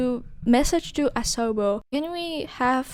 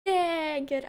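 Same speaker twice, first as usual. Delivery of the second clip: ordinary talk, nothing marked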